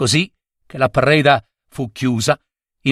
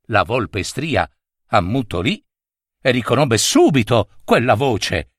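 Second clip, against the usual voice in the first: plain reading voice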